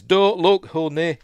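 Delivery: high, loud urgent warning. Retold or imitated. Mother shielding her daughter from the horror, urgent loud warning. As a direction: shout to protect the child. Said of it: "Rose says".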